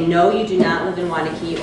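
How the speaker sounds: usual speaking voice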